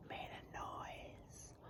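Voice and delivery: a secret breathy hush; hushed whisper. A narrator's reading. Teacher whispering the scary moment to children. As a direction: whisper it, building suspense. Storyteller hushes for the thing's noise.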